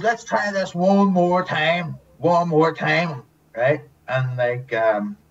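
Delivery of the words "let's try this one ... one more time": patient director. Retold or imitated. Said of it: "So he goes".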